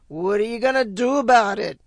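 put-on loud, tough Brooklyn accent. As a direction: snap it like a Brooklyn tough guy. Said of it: "put on my best Brooklyn accent".

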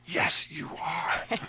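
slow unvoiced whisper; retold whisper. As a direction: whisper it slowly, like a secret. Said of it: "I whispered to her".

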